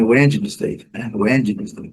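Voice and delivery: "Wednesday" in three syllables with the D sounded; learner's spelling-based "Wednesday"; beginner's mispronunciation. Shown as a demonstration. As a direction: say "Wednesday" as WED-jee-nes-day. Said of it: "A gente pronunciava antes".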